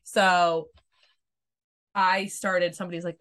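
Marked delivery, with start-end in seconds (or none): none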